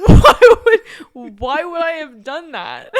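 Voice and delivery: incredulous retort, laughing; mock indignation. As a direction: imitate an appalled refusal, cracking up. Sister's indignant reply retold.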